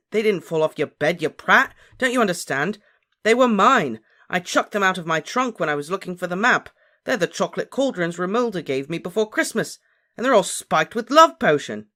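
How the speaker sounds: high, loud, insistent tirade; exasperated shouting. A narrator's reading. Harry explains love potion to Ron, exasperated shouting.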